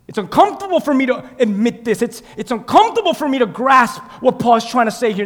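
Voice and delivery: loud, high sermon with shouted exclamations; full-force preaching. Acted. Preacher reading Romans to the church, rousing full-force preaching. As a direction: build from insistent preaching to shouted praise.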